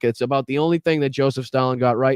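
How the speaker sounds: normal talking voice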